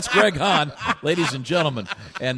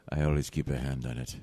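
low, purring Italian lover; purring latin lover; 7 semitones lower than usual. Pretend. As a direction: purr it like a smooth Latin lover. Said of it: "some Italian lover thing".

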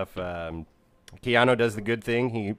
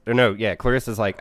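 ordinary talk, nothing marked

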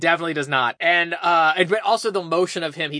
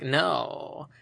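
soft, slightly low one-word answer; lightly imitated terse boy; imitating his talk; 3 semitones lower, 7 dB quieter than usual. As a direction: drop it quietly, mimicking the character. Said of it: "that's how he talks like this".

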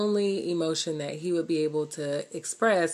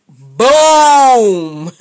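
sudden loud, higher boom; explosive boom; 7 semitones higher, 16 dB louder than usual. Pretend. Wife acting out the argument blowing up, loud boom. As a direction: blurt it out like an explosion. Conflict erupting.

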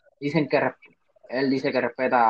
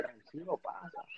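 soft; 2 semitones higher, 19 dB quieter than usual. Retold or imitated. Quoting calm inviting words.

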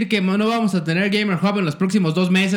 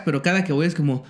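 usual speaking voice

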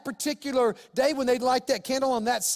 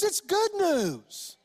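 "good" said high and slowly drawn out; "good" lifted and stretched for emphasis; stress on good; 8 semitones higher, much slower than usual. Real, not acted. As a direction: lean hard on "good" in "it's good news". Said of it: "It's not bad news".

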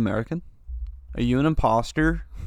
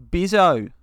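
put-on Australian accent; 4 semitones higher, 4 dB louder than usual. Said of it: "say these in an Australian accent".

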